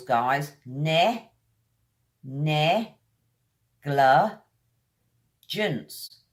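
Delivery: ne, ne, gla, gence each said apart; syllable drill. Shown as a demonstration. Teacher drilling a tricky word syllable by syllable. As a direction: split Negligence into slow parts. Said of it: "Kind of an unusual sound".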